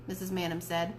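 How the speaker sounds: ordinary delivery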